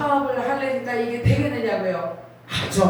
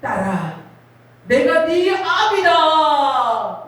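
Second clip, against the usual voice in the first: slow, louder, higher solemn proclamation; voice of God; 5 semitones higher, 6 dB louder, much slower than usual. Retold or imitated. God calling to a weeping girl, slow booming voice. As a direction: proclaim it slowly from above. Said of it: "위로부터 음성이 들립니다".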